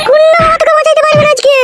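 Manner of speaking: high-pitched angry warning; menacing threat. Acted. Wife warns nobody can save husband today, high angry threat.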